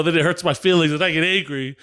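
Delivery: normal talking voice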